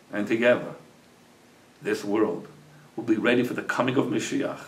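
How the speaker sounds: soft, low closing address to listeners; heartfelt moral. Real, not acted. Storyteller tells dear friends the lesson, soft heartfelt moral. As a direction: close the tale gently and earnestly, with long pauses.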